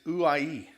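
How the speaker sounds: uai said as three syllables, oo-ah-ee; uai, each syllable apart; Greek word uai. Shown as a demonstration. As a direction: sound out uai slowly, oo-ah-ee. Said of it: "here's the word".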